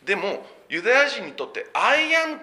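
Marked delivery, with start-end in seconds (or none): 1.7-2.4 I am sung out high and loud; I am lifted high; exalted I am. Shown as a demonstration. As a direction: ring out I am as the holy name. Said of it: "っていう表現".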